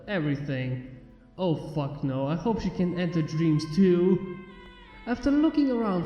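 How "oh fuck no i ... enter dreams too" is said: ordinary delivery, nothing marked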